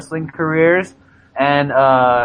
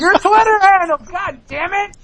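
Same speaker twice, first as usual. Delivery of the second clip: exasperated shout. Real, not acted. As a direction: yell it at him in frustration. Yelling at friend to plug.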